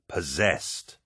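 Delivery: "possessed" stressed, drawn out slowly; brutal emphasis. A narrator's reading. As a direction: lean hard and slowly on "possessed". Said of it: "repeating the word with brutal emphasis".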